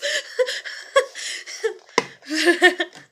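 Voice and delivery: loud, drawn-out fit of laughter; long real laugh. Real, not acted. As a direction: let the laugh roll on. Laughs at herself being smart ass.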